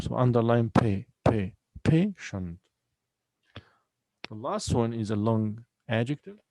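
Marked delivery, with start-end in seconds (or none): 0.7-2.6 "patient" with PAY isolated and stressed; stress on first syllable. Shown as a demonstration. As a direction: repeat a strong "pay", then slow split "patient". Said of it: "on the first syllable".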